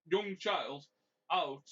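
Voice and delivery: normal talking voice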